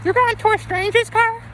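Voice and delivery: put-on very high squeaky kid voice; high-pitched mock disbelief; shocked kids. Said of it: "I told my kids the story".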